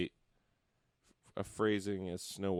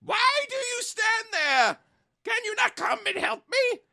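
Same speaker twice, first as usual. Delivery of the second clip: angry dwarf cry; 11 dB louder than usual. A narrator's reading. Dwarf with his beard stuck, yelling at the girls. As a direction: shriek it like a furious little dwarf. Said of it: "glared at the girls ... and cried".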